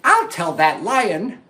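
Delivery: high squeaky frog voice, fretful; a worried little frog panicking. Acted. Little Frog waking late and panicking about the lion.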